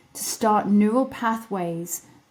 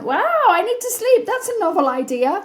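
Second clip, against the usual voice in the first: much higher, louder, hammed-up wow; mock amazement; 12 semitones higher, 5 dB louder than usual. Mocking her own sudden realisation, high hammed-up wow. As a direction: play the discovery as a big sarcastic surprise. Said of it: "I'm like".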